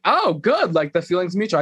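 mock excited, high-pitched retort. Retelling a castmate relishing a feud, high excited voice. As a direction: pitch it up, pleased at the challenge. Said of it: "I think she got excited".

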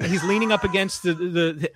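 ordinary talk, nothing marked

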